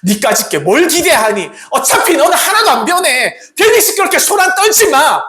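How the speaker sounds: high, loud sneering scorn; cold contempt. Acted. People sneering at blind Bartimaeus, cold scorn. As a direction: belittle him with contempt. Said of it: "차갑게 이야기했던 것입니다".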